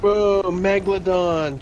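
loud drawn-out amazed whoa; excited. Real, not acted. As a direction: gasp it out in awe, slowly. Fireworks burst in the sky.

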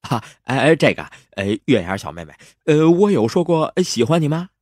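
high-pitched, stammering hero playing innocent; awkward feigned innocence, high and hesitant; flustered sweet-talker.

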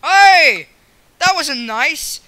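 loud, high-pitched protest; indignant shout. Real, not acted. Gamer to an attacking player, indignant shout. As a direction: yell at whoever just hit you.

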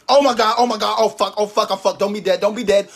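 hammed-up terror over a trip; mock panic. Mom panics when child falls.

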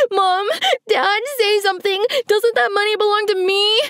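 high, tearful pleading. A narrator's reading. Crying sister pleading with the parents. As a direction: beg the parents for help. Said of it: "she pleaded".

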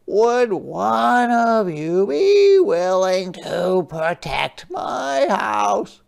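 high, slow, gentle crab voice; a small polite sea creature asking; Hermit Crab voice. Acted. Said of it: "said Hermit Crab".

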